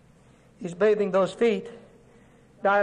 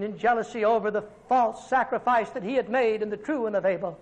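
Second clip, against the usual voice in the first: ordinary talk, nothing marked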